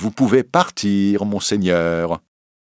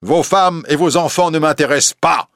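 cruellement; 9 semitones higher, 6 dB louder than usual. A narrator's reading. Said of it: "répond cruellement l'évêque".

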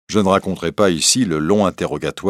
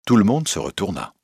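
ordinary delivery

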